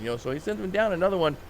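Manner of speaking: ordinary talk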